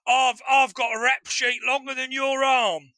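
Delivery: put-on British accent. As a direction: boast like a hard man in a British accent. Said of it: "British accent".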